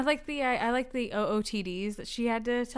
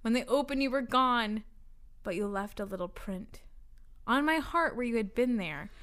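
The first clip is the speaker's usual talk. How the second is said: measured, lilting poem recital; poetry reading. Real, not acted. Reading mystery fan poetry to friends, measured recital. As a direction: read it as earnest verse with pauses.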